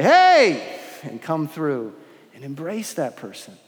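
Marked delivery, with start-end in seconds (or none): 0.0-0.8 high, loud, bright "Hey"; joyful welcome. Pretend. The church family welcomes the lonely orphan, joyful call. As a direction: greet the orphan with open delight.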